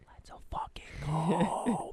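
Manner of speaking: slow whispered celebration held back; suppressed whisper. Retold or imitated. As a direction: cheer silently so she can't hear. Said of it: "I kept my cool".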